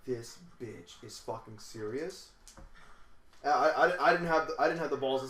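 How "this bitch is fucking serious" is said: soft and slow, under the breath; muttered disbelief. Retold or imitated. Inner thought, disbelief.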